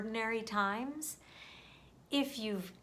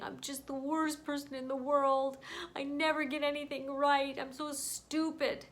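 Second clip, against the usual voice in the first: higher, a touch of tearful mimicry; imitating her sobbing; 5 semitones higher than usual. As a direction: echo a crying child's despair, lightly.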